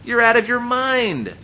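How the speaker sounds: high, loud, slow outburst at Paul. Retold or imitated. Festus shouting at Paul in court, loud outburst. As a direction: cry out that he's lost his mind. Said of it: "Festus said in a loud voice".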